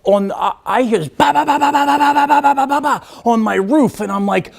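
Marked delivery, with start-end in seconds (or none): 1.3-4.0 woodpecker tapping. Retold or imitated. Imitating woodpecker tapping on roof.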